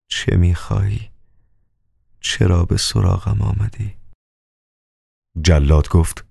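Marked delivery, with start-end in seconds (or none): 0.1-3.9 soft, hoarse, moaning plea. A narrator's reading. Crucified man moans to the executioner, hoarse and soft. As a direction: groan the words out, barely able to speak. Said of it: "با صدایی گرفته نالید".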